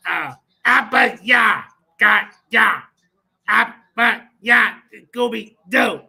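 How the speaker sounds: slow loud mock chant of Abba Yah; mock Paleo Hebrew. Host testing the war chief with fake Paleo Hebrew, slow loud chant. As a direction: ham up fake Hebrew slowly. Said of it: "if you can understand what I'm saying".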